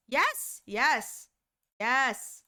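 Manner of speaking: ordinary delivery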